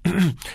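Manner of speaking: short throat clear before reading aloud; preparatory throat clear. Acted. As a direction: clear your throat to begin reciting. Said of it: "گلویش را صاف کرد".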